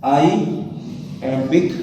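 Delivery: "iambic" stretched out, pause inside; drawn-out term. Shown as a demonstration. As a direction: say "iambic" slowly in separated parts. Teacher breaks term up for students.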